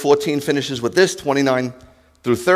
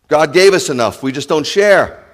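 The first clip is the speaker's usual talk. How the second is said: usual speaking voice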